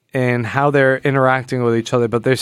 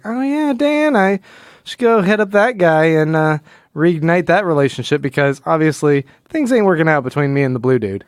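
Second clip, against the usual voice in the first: high, breezy put-on voice that settles back to normal by the end; mock Laurie; 3 semitones higher than usual. Host voicing Laurie musing about Dan, high flippant mock voice. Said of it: "She's like".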